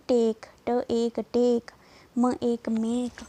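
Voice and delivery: टेक as ट एक टेक, loud and slow; sounding out words. Shown as a demonstration. Teacher loudly sounding out टेक and मेक for the class. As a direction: break टेक into ट and एक, then say it whole. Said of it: "Hindi में हम कैसे बोलेंगे".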